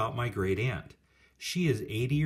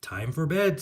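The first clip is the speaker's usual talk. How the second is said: louder, higher call to bed; 6 semitones higher, 5 dB louder than usual. Acted. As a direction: call it across the house. Mom calls kids to bed.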